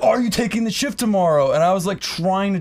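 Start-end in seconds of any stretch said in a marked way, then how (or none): 0.0-1.5 higher, snooty boss voice; mock the condescending manager. Manager asking again.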